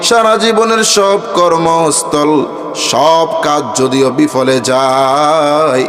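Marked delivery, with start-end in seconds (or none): none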